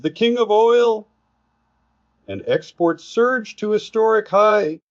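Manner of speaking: very high, hyped-up boast; exclaimed headline. Retold or imitated. Commentator reading an amplified oil headline, exclaimed very high. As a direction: proclaim it like a boastful headline, pitch soaring.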